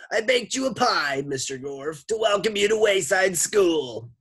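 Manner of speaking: high, loud cartoon lady voice; sloshy lunch lady. A narrator's reading. Lunch lady calls through the door, high sloshy holler. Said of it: "like two boots sloshing through the mud".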